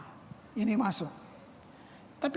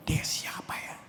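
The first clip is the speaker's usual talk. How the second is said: hushed, wondering whisper; awed whisper; 4 dB quieter than usual. Retold or imitated. Disciples marvelling at Jesus, whispered in awe. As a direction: ask it breathless with awe.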